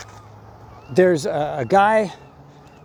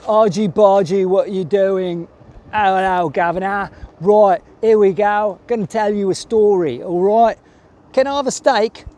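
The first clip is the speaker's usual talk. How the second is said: Guy Ritchie Cockney; 3 semitones higher, 4 dB louder than usual. Retold or imitated. Hugh Grant's character spinning Charlie Hunnam a yarn, mock Cockney accent. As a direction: lay on a thick mock Cockney, a cheeky geezer spinning a yarn. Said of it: "doing this Guy Ritchie".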